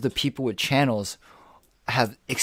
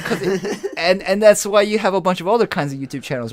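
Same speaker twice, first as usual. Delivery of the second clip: laughing; 8 semitones higher, 7 dB louder than usual. Real, not acted. Laugh heard.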